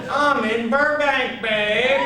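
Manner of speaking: high, slow, laughing boast; gloating laugh. Retold or imitated. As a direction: savor the daydream. Fantasy of success.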